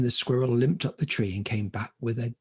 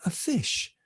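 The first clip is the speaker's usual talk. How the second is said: plain reading voice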